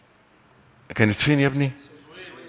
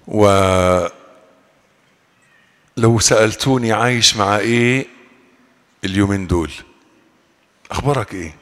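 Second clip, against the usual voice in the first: normal talking voice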